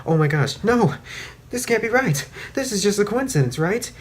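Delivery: high, frightened inner voice; fast, high denial, trying not to panic; panicked. Retold or imitated. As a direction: Rainbow Dash freaking out at the cupcake, high and scared. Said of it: "in her head she's like".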